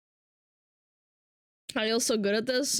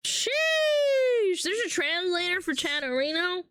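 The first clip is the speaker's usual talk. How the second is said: high drawn-out exclamation, then raised questioning; higher-pitched astonished question; surprised; 6 semitones higher than usual. Real, not acted. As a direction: react amazed at the news. Reacts to the question just read.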